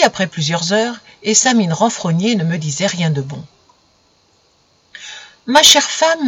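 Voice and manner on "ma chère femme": higher, louder lordly husband's call; the sun addressing his wife with pomp. Acted. Sun speaking to his wife, lordly. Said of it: "me dit-il".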